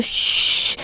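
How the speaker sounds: put-on soft voiceless shush to quiet the child. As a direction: hush him gently, secret between them. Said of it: "धीरे". (धीरे से श्श्श)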